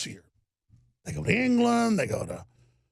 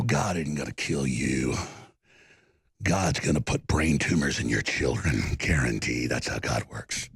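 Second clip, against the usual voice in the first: much lower, hushed and menacing; low ominous threat. Real, not acted. A host cursing his enemies, low and ominous. Menacing curse after rant.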